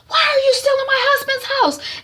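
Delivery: very high, loud demand; furious mother. Retold or imitated. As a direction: screech it in acted rage. Mother rages at daughter.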